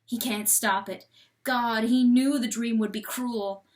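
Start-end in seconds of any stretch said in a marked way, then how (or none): none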